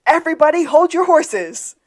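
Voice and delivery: loud, high hollered command; mock scream. Recapper playing Sean riding in, mock-heroic shout. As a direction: holler it like a grand entrance. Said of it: "comes in on a horse and screams".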